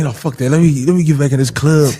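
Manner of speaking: half-acted rush to get back inside; retold urgency. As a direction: blurt it out like you just decided. Retold panicked decision.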